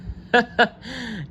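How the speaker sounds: high squeaky laugh. Acted. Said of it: "Moose began to laugh again".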